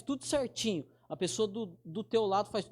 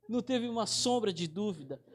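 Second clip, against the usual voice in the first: usual speaking voice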